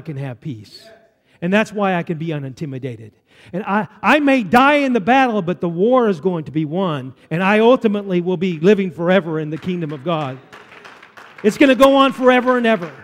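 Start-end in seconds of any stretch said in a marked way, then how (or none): 3.5-12.9 loud, rousing pulpit proclamation; preaching at full force. Real, not acted. Preacher proclaims the war will be won, full-force preaching. Sermon climax on victory.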